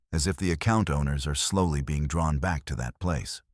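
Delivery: ordinary delivery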